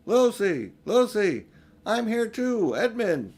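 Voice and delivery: calling out loud and high; shouting for his sister through the snowy trees. Acted. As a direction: call out across the empty wood. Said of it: "and so he shouted".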